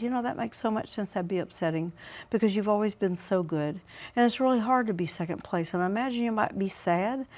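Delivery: soft, tender reassurance; mother comforting her son after losing first chair, soft; empathetic. Shown as a demonstration. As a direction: comfort a disappointed child. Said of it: "that's the empathy".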